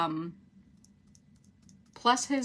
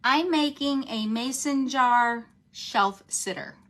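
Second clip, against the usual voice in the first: slow and louder, each word spaced; said slowly; 3 semitones higher, 5 dB louder, slower than usual. Shown as a demonstration. Host tells viewers the project, slow and deliberate. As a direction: spell it out slowly so they catch it. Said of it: "I had to say it slow".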